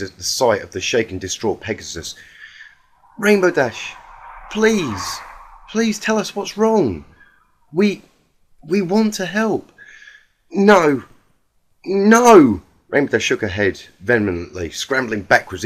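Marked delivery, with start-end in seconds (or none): 3.2-9.7 higher, timid voice of a gentle pony; gentle Fluttershy. Acted. 10.5-12.6 high, loud panicked no; frantic refusal. Acted. Rainbow Dash refusing help in panic.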